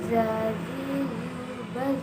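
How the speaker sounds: "za, zi, zu, baz" with tongue at upper teeth, voice flowing without air; soft flowing z. Shown as a demonstration. Teacher giving examples of the letter Za, soft flowing z. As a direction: let the voice run smoothly through each z in "za, zi, zu, baz". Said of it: "suara mengalir dengan lembut".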